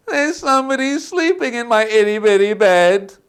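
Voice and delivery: put-on high, loud whiny child voice; Baby Bear. Baby Bear finding his porridge gone, high whining. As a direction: whine it high like an upset little cub. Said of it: "and said".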